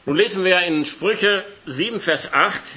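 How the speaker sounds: ordinary talk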